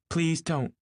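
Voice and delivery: high, urgent interruption; helpless pleading. A narrator's reading. Daisy interrupting Gatsby's confession, helpless pleading. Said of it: "she interrupted helplessly".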